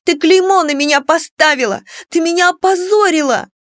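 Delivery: high, loud accusation; accusing shout. A narrator's reading. Daughter blaming her mother for disgrace, angry shout. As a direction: shout the blame straight at her. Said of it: "кричала в лицо матери Клара".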